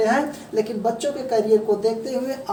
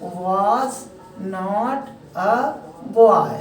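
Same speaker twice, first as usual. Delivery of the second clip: slow teaching demo; 3 semitones lower, much slower than usual. Shown as a demonstration. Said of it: "तो सीधा सीधा कह देना है".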